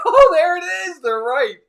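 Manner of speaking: high, loud delighted cry; excited discovery. Retold or imitated. Rider spotting the flux capacitor while waiting, thrilled. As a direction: burst out like you just spotted it. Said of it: "I'm like".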